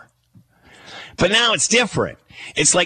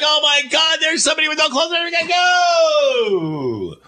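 mock astonished shout; 11 semitones higher than usual. Onlooker spotting a naked streaker, shouting in mock amazement. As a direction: yell it like you can't believe your eyes.

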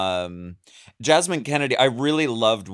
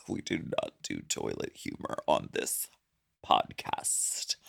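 hushed, voiceless scolding; mock-offended whisper, far softer than usual; mock indignation; 12 semitones lower, 11 dB quieter than usual. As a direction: whisper it, scandalized. Mock outrage at fart talk.

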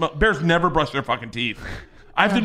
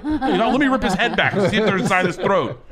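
laughing; 6 semitones higher, 4 dB louder than usual. Real, not acted. Laughing while retelling.

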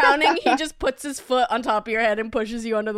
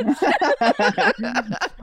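laughing hard. Real, not acted. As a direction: break into open laughter. Laughing at own story.

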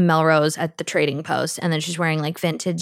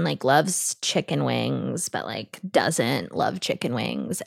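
low, slow creaky drawl; vocal fry; 7 semitones lower, 6 dB quieter, slower than usual. Retold or imitated. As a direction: drop into a bored vocal fry. Mimicking unbothered cool girl.

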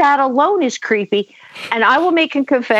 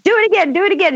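excited; 10 semitones higher, much faster than usual. Retold or imitated. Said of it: "and I said".

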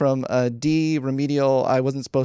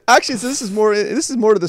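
laughing; 9 semitones higher, 8 dB louder than usual. Real, not acted. Laughing while continuing her story.

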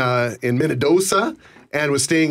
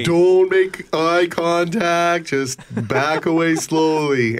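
very slow, drawn-out words; slow-motion pretend warning; mock cautious warning; much slower than usual. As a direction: spell out a careful retreat, word by word. Acting out a wary retreat.

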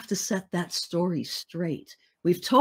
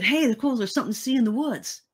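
lightly voiced eager child, higher and livelier; a hint of childlike curiosity, pitched up; curious child; 5 semitones higher, faster than usual. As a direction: imitate a little kid's excited discovery. Voicing her seven-year-old self's thought.

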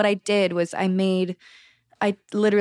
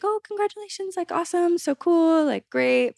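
much higher, sing-song fake cheer; fake congratulations; 10 semitones higher than usual. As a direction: gush politely while hiding annoyance. Fake congratulations then real reaction.

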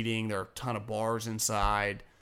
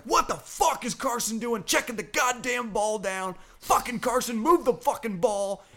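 much higher mock-furious rant; imitating angry mom; 12 semitones higher, 4 dB louder than usual. Host imitating an angry Eagles mom in the stands, mock-furious rant. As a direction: channel a livid mom yelling at her quarterback. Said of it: "she's super pissed off".

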